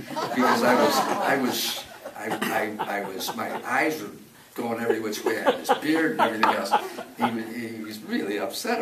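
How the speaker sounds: real laughter, loud; laughing. Real, not acted. Telling how he looked to his son, laughing loudly. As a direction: laugh through it while you explain.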